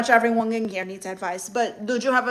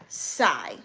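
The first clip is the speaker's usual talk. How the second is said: long sigh dropping low; withering sigh; 12 semitones lower, 4 dB quieter than usual. Shown as a demonstration. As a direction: sigh it out, deeply unimpressed. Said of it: "Why are you sighing like that?".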